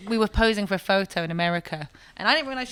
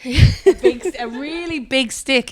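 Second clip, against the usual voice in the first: laughing; 6 semitones higher, 7 dB louder, slower than usual. Real, not acted. Laughing, exaggerating the stick.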